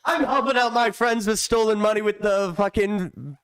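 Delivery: high, smug mock-politician voice. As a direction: play the smug crooked politician. Mocking a politician's voice.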